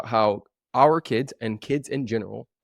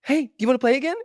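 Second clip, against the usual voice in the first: high, bright-voiced child's invitation; cheerful kid; 8 dB louder, much faster than usual. Dad voicing his kid inviting the other to play. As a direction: ask like a kid who's already forgotten the fight. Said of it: "they're like".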